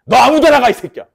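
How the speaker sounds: sudden loud, high dismissive yell; mock-angry shout. Teacher firing the last arrow at any target, mock-angry shout. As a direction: explode on the order, drop off on the insult. Acting out random arrow assignment.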